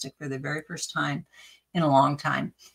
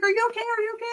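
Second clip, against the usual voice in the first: retold worried husband, much higher; much faster than usual. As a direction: mimic the anxious husband asking twice. Husband voiced in retold scene.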